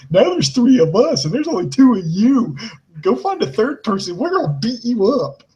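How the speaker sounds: high-pitched mock Jersey accent; bad Jersey accent. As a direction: mimic her promo in a hammed-up Jersey accent. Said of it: "in the worst Jersey accent".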